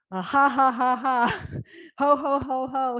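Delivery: loud ha ha ho ho laughs; hearty fake laughter. Shown as a demonstration. Teacher demonstrating laughter sounds to the class, loud and hearty. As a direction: laugh it out big and round. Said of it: "words they use for laughter".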